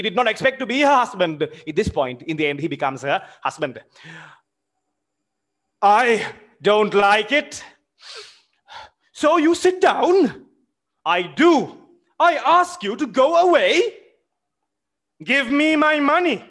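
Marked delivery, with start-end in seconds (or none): 5.8-16.4 high, loud furious demands; angry shout. Acted. Smirnov shouting at the widow for his money, furious. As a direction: yell at her to hand over the money.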